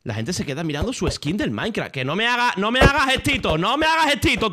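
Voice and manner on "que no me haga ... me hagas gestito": higher, louder scolding; telling off. Real, not acted. To a player making gestures, loud scolding. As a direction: warn him off, getting louder.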